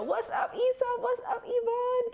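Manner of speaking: high-pitched, sing-out greeting; playful shout-out. Pretend. Vlogger greeting her favorite TV show characters, playful high shout-out. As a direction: call out to them in a high playful voice.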